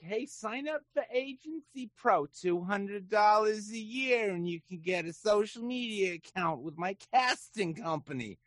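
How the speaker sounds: high-pitched huckster spiel, drawn out; sarcastic, high-voiced promoter impression; mock sales pitch. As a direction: pitch it like a cheesy advert. Mocking an agency's sales pitch.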